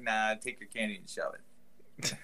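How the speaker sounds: dismissive brush-off. Retold or imitated. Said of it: "I'd be like".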